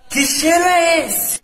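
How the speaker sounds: high, slow, shouted alarm; yelling to the class. Retold or imitated. Classmate shouting to the room, high and slow. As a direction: yell it for the whole room. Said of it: "ela grita pra sala toda ouvir".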